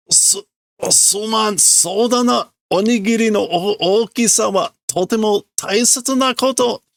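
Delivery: put-on high flustered boy, tripping over the words; stumbling apology. Flustered boy stammers an apology to Yotsuba, high and rushed. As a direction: stammer out a nervous excuse. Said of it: "he's stumbling".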